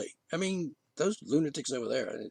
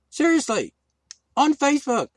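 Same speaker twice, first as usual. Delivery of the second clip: indignant; 7 semitones higher, 7 dB louder than usual. Real, not acted. Outraged exclamation.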